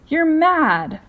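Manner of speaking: high, loud outraged cry; cried out. A narrator's reading. Earthworm cries out at James's plan, loud and high. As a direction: fling the words out in disbelief. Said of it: "cried the earthworm".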